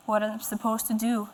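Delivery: ordinary talk, nothing marked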